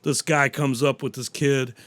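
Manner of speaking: ordinary delivery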